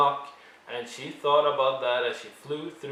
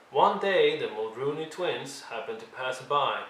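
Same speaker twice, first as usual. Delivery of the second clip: usual speaking voice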